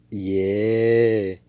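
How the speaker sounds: long loud drawn "yeah"; loud cheer. Real, not acted. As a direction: hold it out like a triumphant whoop. Cheering the hero's line.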